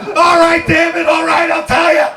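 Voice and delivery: desperate shout. Acted. Prisoner breaking under pressure.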